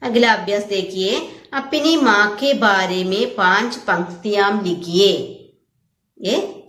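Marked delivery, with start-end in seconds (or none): none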